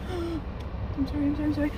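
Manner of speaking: high sobbing apologies; crying in fright. Real, not acted. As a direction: whimper sorry in real panic. Spider panic.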